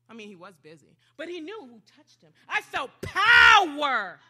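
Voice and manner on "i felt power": loud, drawn-out burst on power; booming exclamation. Acted. Jesus feeling power leave him, loud drawn-out cry. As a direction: blast it out slowly, very loud.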